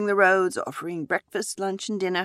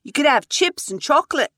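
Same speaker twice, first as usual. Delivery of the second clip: put-on excited child; 9 semitones higher, 7 dB louder than usual. Son suggesting treats.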